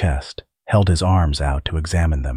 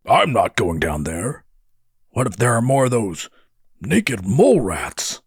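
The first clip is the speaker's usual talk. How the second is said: higher-pitched character voice, balking; incredulous refusal, pitched up; reluctant, alarmed brother; 5 semitones higher than usual. A narrator's reading. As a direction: protest in an animated, put-on voice. Narrator voices Darl balking at the descent.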